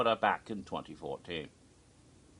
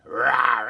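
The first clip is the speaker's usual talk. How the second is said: loud mock dragon "rawr"; dragon roar; 9 dB louder than usual. Scary dragon chasing them off the stage, mock roar. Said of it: "a scary dragon".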